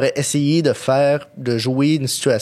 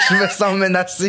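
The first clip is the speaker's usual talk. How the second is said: laughing; 5 semitones higher than usual. Real, not acted. Laughing after being teased.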